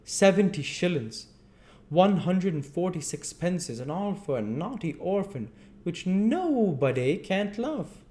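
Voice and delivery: high, loud pompous lecture; hammed-up pompous beadle scolding; awful pompous beadle. A narrator's reading. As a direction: preach at the orphan grandly. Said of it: "delivering this address in an awful voice".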